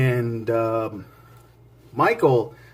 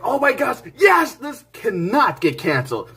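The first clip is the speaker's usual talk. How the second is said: high, thrilled yell of a hyped fan; overjoyed fan cry; excited shout; 7 semitones higher than usual. Retold or imitated. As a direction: shout it like the news just broke. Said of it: "I was like".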